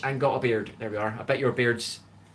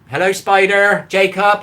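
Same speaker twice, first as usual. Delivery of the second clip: loud, higher fed-up hello; exasperated greeting; 6 semitones higher, 11 dB louder than usual. Real, not acted. Streamer greets the same viewer yet again, loud and fed up. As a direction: belt out the repeated greeting. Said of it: "saying hello to the same people over and over".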